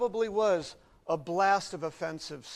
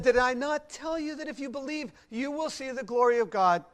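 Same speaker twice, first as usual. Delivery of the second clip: higher-pitched, insistent quoted question; firm, raised-voice reproof from the pulpit; emphatic challenge; 5 semitones higher than usual. Acted. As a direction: press the reminder home with conviction. Preacher quotes Jesus challenging Martha.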